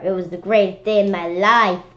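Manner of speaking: ordinary delivery, nothing marked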